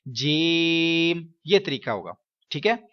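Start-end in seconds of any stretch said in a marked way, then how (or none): 0.1-1.3 जीम held slow for three seconds without nasal sound; teacher modelling a clear long जीम; correct long jeem. Shown as a demonstration. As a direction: draw जीम out clean, nothing through the nose. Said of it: "तीन second लंबा भी करवाना है".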